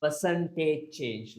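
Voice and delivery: slow Percentage change with weight on "Percentage"; teacher stressing Percentage. Shown as a demonstration. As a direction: lean on the word "Percentage" slowly. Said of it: "I emphasize this word".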